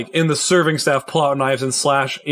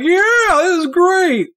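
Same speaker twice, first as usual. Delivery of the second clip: put-on high, drawn-out drunken whoop; tipsy cheering crowd; 4 dB louder, slower than usual. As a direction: cheer like an intoxicated party guest. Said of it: "who are more intoxicated are like".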